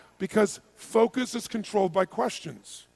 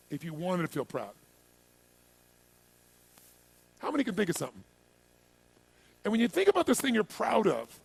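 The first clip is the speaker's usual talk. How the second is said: soft, lower coaching voice; guided reflection; 5 semitones lower, 4 dB quieter than usual. Real, not acted. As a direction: guide them gently inward, leaving room to feel. Leads a reflective exercise.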